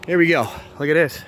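breathless after running; panting a little from running; out of breath. Real, not acted. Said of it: "Sorry, I'm out of breath".

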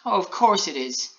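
high mild protest; mildly put-out little gingerbread man; Alan Taylor, displeased. A narrator's reading. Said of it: "replied Alan Taylor with a frown".

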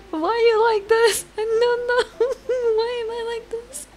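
high, laughing, teasing herself; giggly squeaky voice. Real, not acted.

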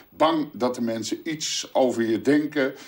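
usual speaking voice

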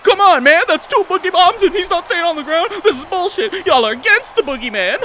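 high, loud outraged shouting; angry yelling. Real, not acted. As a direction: rant loudly at the game. Angry at the opponent.

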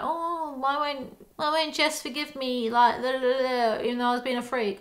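mock her self-pity, singsong; mocking whiny Bella. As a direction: parody of a moping teen.

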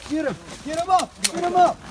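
loud urgent battlefield shout. Acted. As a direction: yell to get him on his feet.

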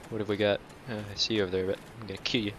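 ordinary delivery

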